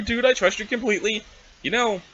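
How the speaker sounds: ordinary talk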